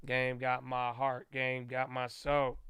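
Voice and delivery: soft and slow, defeated sigh of a line; resigned mutter. Retold or imitated. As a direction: mumble it like you've given up on the game. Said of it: "now I'm kinda like".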